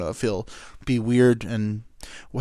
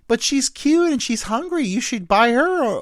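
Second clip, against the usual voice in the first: put-on whiny girl voice; 12 semitones higher, 3 dB louder than usual. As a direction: plead like a spoiled girl. Friend argues for her.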